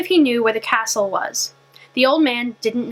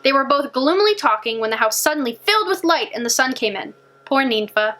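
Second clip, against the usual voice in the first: usual speaking voice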